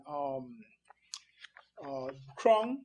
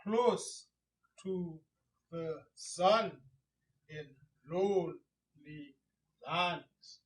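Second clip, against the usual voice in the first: very slow, paused beats stressing "Close", "sun", "lonely", "lands"; metrical stress; slower than usual. Shown as a demonstration. Teacher showing stress in the second line, slow beats on "Close", "sun", "lonely", "lands". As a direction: separate each beat, weight on "Close", "sun", "lonely", "lands". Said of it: "So we stress that".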